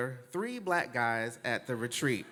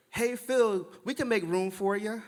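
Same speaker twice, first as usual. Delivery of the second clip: high-pitched, louder mock-eager invitation; Heather's friendly call; 9 semitones higher, 4 dB louder than usual. Woman inviting him to her table, mock-friendly call. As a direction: call out in a put-on chirpy voice.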